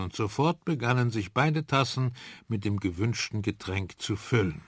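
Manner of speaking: plain reading voice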